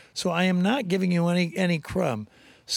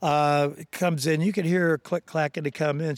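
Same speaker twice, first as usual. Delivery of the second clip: usual speaking voice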